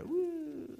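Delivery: high joyful whoop while waving palms; cheer. Real, not acted. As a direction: let out a happy woo.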